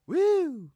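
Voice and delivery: high, loud "woo"; excited whoop. Real, not acted. Host relishing Vegas party plans, excited whoop.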